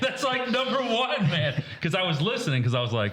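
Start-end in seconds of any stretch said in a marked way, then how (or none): 0.0-1.6 high, laughing scold; exasperated friend. Retold or imitated. The friend telling the player eye protection is rule one, high amused scolding. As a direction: lecture him high and amused. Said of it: "He's like".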